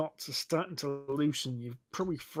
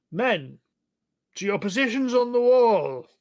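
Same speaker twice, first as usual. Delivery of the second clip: loud, raised-pitch military command; captain's shouted order; 8 semitones higher, 8 dB louder than usual. Acted. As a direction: call the troops to their posts. Captain calls orders to his guards.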